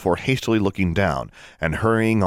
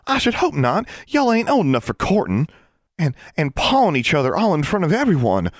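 much higher, shocked Ozark twang; scandalized Southern exclamation; 9 semitones higher, 3 dB louder than usual. A narrator's reading. Constance scolds the pair, scandalized and twangy. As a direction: clutch your pearls in a country accent. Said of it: "Constance exclaimed".

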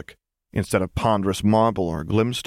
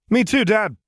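put-on much higher, eager child; kid's voice; 13 semitones higher, 4 dB louder than usual. A narrator's reading. Son calling to Dad from the sofa, eager and high. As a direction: pipe up like a cheeky kid.

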